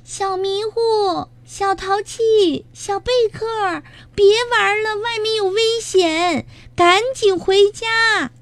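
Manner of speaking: put-on high childlike hollering into the distance; calling out. A narrator's reading. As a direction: call the little ones home. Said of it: "帮着呼喊".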